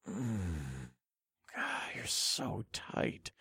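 slow, whispered murmur; barely voiced, drawn out slowly; low murmur. A narrator's reading. Narrator close to his lover, murmuring. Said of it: "I murmured in a low voice".

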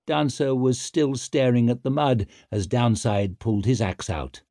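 ordinary delivery, nothing marked

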